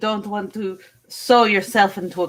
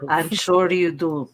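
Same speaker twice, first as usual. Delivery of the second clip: put-on heavy Russian accent; 5 semitones lower than usual. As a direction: play it as a Russian contestant. Miss Russia.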